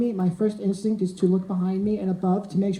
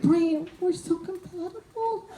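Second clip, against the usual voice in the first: dreamy plea; 7 semitones higher, 4 dB quieter, slower than usual. Retold or imitated. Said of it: "but I'm like".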